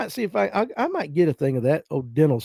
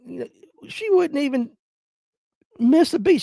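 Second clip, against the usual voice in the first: ordinary talk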